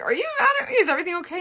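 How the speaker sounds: high, laughing mock worry; laughing worried question. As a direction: laugh through a fake-anxious question. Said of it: "I'm like".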